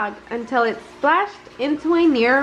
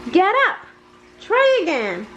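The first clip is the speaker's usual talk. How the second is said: called out; 7 semitones higher, faster than usual. Acted. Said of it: "Thumper called out".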